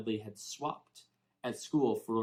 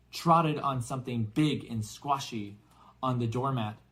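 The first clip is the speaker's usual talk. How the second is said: ordinary delivery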